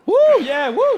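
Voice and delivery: loud, high mock cheering whoop. Mocking cheering crowd.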